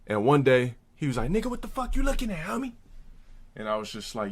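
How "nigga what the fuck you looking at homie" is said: high, fast mock confrontation; retold challenge. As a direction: imitate a rival picking a fight, pitched way up.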